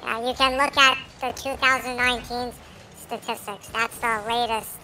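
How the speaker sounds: sped-up, squeaky voice changer; chipmunk voice. Real, not acted. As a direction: talk through a chipmunk filter. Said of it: "the middle guy is using the chipmunk voice".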